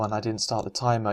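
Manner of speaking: ordinary talk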